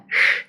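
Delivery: "sh" as a drawn hushing consonant; French sh sound. Shown as a demonstration. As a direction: hiss out "sh" on its own. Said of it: "it's really hard to pronounce the".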